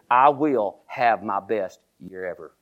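slow, deliberate affirmation; steady, measured vow; slow declaration. Shown as a demonstration. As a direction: lay out each word firmly. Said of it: "say it with me".

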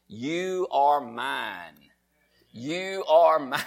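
very slow, drawn-out declaration; slow and low, letting each word sink in; savoured scripture. Acted. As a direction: linger on every word of the promise. Savours a verse he likes.

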